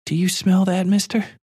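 put-on high, childlike little girl asking; a small girl sniffing the air, uneasy. A narrator's reading.

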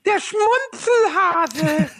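put-on slow, high lisping kid voice; lisping child. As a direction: lisp it like a small child in an ad. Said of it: "dann lispelt dieses Kind immer so".

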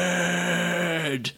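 name stretched out absurdly long; comically slow-motion delivery; extremely slow. Retold or imitated. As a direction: drag RICHARD out on one held note.

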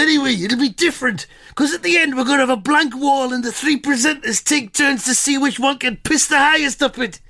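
high, plummy royal voice; King Charles impression. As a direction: pitch a TV show like the king. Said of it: "proper impression".

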